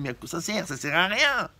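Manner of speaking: high, whining student; whiny complaint. Retold or imitated. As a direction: complain it's useless. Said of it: "Tu dis".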